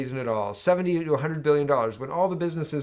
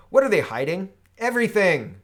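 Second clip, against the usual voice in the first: louder, higher indignation; indignant; 6 semitones higher, 7 dB louder than usual. Real, not acted. Host accusing government of hiding data, indignant. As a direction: fire the answer back.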